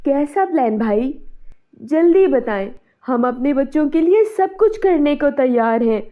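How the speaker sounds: urgent, emphatic mother's plea; desperate pleading. Acted. Mother begging neighbour for the plan, desperate urgency. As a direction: plead as if everything depends on it.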